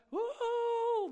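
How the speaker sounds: high, drawn-out wail of "woo"; high mock-panic "woo". Preacher mimicking worriers as the sky falls, mock-panic woo. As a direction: let out a soft, high worried hoot.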